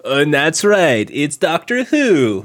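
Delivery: slow, drawn-out punchline; exaggerated punchline delivery. Podcaster playing along with the teasing, slow hammed-up punchline. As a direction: draw every word out like a big reveal. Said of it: "is that how you deliver your jokes?".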